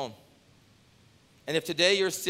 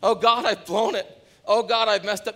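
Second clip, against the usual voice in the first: mock repentant prayer; 8 semitones higher, 8 dB louder than usual.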